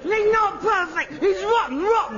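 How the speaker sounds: high, heated contradiction; indignant protest. Acted. Son protests the casting.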